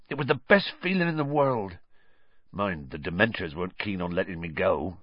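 a hint of big Hagrid, rising to a pained pitch; lightly voiced grieving Hagrid. Hagrid tells the children of Azkaban, mild grief. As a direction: let old sorrows well up.

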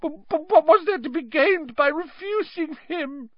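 very high, stammering whine; frightened high-pitched protest; gasping excuse. A narrator's reading. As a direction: stammer it in squeaky panic. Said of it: "gasped Pettigrew".

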